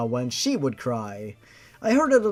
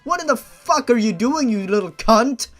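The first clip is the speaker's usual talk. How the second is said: higher, loud outraged yelling; furious rant; 5 semitones higher, 5 dB louder than usual. Real, not acted. Ranting at the boy character.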